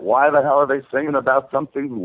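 usual speaking voice

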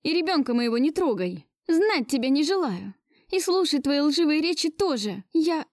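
high, agitated refusal; budding hysteria. A narrator's reading. Mother warding Gleb off her child, rising hysteria. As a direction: let the voice climb toward hysterics. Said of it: "прервал мою зарождающую истерику".

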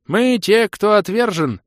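put-on high, loud, chanting old creature voice; mumbling old creature. A narrator's reading. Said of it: "прошамкал лохр".

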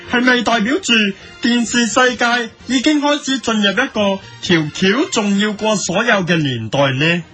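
ordinary delivery

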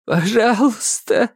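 high, breaking voice of despair; срывающийся голос. A narrator's reading. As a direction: beg with the voice cracking. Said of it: "срывающимся от отчаяния голосом". (голос, срывающийся от отчаяния)

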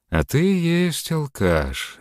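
high, slow taunting inner voice; mock yourself from inside. A narrator's reading. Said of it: "вновь вступил внутренний голос".

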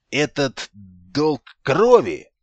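slow portentous ritual voice; solemn. A narrator's reading. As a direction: intone it gravely, very slowly. Solemn pseudo-ritual pronouncement.

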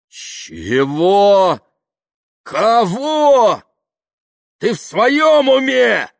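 rising, drawn-out shouts of outrage; yelling back. A narrator's reading. Kaftanov outraged at the spying accusation, yelling back. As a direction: build to a high, furious bellow. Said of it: "заорал в ответ Кафтанов".

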